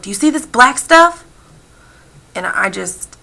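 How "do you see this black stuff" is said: high, louder accusing question; disgusted. Retold or imitated. Man showing the storyteller his blackened fingertips, disgusted. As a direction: imitate his indignant disgust, pitch raised. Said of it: "giving me this disgusting look".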